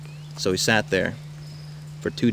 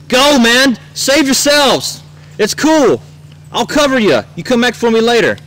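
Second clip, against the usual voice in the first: loud, high shouts in bursts; dramatic all-out battlefield shouting; heroic yell; 10 dB louder, slower than usual. Acted. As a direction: holler it up to a departing helicopter. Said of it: "I yelled at him".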